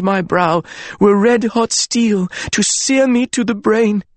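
high, clear voice with a waver; grieving queen recital. Acted. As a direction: declaim it high and trembling. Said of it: "Her words rang high and clear, but they wavered too".

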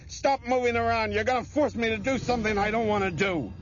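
high, strained, desperate threat; frantic shouting. A narrator's reading. Craig warns his struggling hostage, frantic shouting. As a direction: shout as if losing control. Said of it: "Craig shouted to Bethany".

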